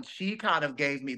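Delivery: normal talking voice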